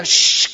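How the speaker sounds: hissed hush to silence him; the crowd shushing the beggar, hissed; sharp shush. Acted. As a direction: shush someone rudely. Crowd hushing Bartimeo.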